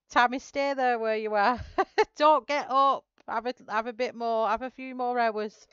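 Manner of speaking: loud, high, laughing insistence; laughing urging. Real, not acted. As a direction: call it out through real laughter. Laughingly urging viewer to rest.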